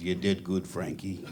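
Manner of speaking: low, soft mobster praise; a quiet mob boss pleased with him. Retold or imitated. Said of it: "a mob-like figure ... he goes".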